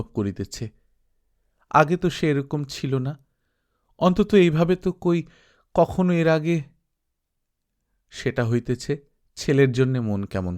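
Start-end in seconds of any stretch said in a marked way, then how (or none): none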